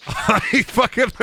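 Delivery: laughs through the words; can't finish for laughing. Real, not acted. Streamer cracking up over the worried chat, laughing.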